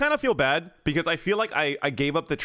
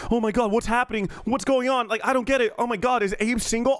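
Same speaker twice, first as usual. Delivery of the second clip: higher-pitched breathless fuss; mock alarmed fans; 6 semitones higher than usual. Mimicking confused replies to a troll tweet, mock alarm. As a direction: imitate a flustered crowd of onlookers.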